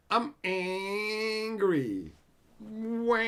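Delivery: high, long drawn-out mock wail; mock angry whine. Streamer mocking angry Magic players, whining. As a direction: whine it like a big baby.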